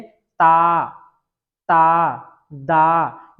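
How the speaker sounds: ता and दा stretched very slowly with the tongue on the teeth; teacher drawing out dental ता and दा; drawn-out dental demo. Shown as a demonstration. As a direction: sound out ता ता दा at a crawl. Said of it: "आप बोल के देखें".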